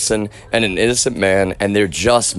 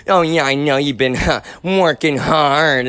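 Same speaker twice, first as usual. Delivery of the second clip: mocking grandmother; 4 semitones higher than usual. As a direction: imitate her, dragging out the last words. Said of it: "my own grandmother".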